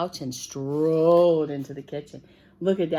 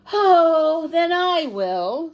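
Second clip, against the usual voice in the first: resigned hen; 9 semitones higher, 8 dB louder, much slower than usual. Acted. Voicing the hen.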